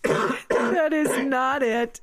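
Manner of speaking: slow, high, emphatic denial; firm denial. Real, not acted. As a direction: draw it out, flatly refusing. Denying his version of events.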